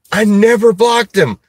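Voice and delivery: high-pitched retold disbelief; mock protest. Acts out his amused disbelief.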